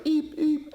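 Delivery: high squeaky bird chirps, twice; copying the parrot's light chirp; imitated parrot chirp. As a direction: chirp like a little parrot wanting something. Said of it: "whenever he does this light chirp".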